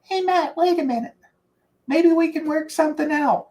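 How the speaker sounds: high, softened mock coach voice; imitating Joe Paterno. Coach relenting as player leaves office, high imitation voice. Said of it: "he'd go".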